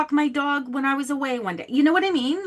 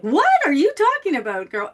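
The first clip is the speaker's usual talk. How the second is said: high, loud mock disbelief, imitating friends; friends' disbelief; 5 semitones higher, 6 dB louder than usual. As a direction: toss it off like a stunned girlfriend. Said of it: "my friends were like".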